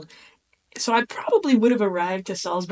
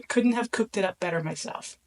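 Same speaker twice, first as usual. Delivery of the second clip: usual speaking voice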